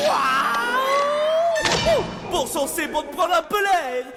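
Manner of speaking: high loud burst of joy; excited shout. Acted. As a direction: shout it out, finally free. Genie bursting out of lamp.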